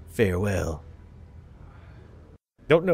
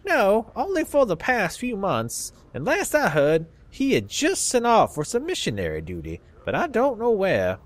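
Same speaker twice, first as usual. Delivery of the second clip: put-on old Southern man, pitched much higher; southern accent; 8 semitones higher than usual. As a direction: play a crusty old Southern dustman in a Southern accent. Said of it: "give old people southern accents".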